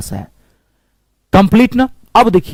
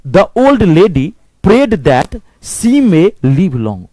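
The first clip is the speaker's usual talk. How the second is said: the full sentence said very slowly, each word apart; slow dictation; much slower than usual. Shown as a demonstration. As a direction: dictate slowly so students can write it. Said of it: "आपको लिखिए". (बहुत धीमे लिखवाना)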